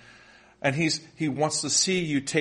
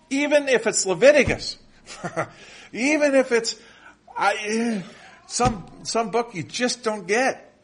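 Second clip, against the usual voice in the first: laughing excitement; 8 semitones higher, 4 dB louder than usual. Real, not acted. Laughing exclamation about Leviticus.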